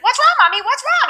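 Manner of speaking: put-on high little-kid voice; worried child impression. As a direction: imitate a child anxiously asking mom. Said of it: "and he's like".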